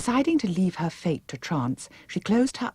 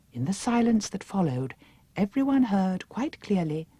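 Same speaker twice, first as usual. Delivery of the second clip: plain reading voice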